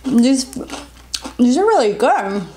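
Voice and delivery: speaking between bites; talking while chewing. Real, not acted. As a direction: mouth partly full. Seen 1: Bites snack at -7, lips pressed and chewing while speaking.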